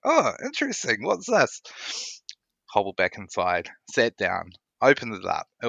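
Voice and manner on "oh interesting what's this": bright, high-pitched curious question; curious surprise. Retold or imitated. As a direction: raise the pitch in mock curiosity. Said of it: "I was like".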